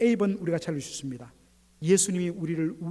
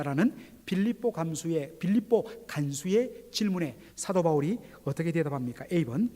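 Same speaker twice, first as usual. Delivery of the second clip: ordinary talk, nothing marked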